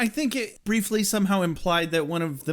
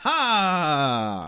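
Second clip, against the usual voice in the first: mock aha; 3 semitones lower than usual. Mock triumphant reveal reaction.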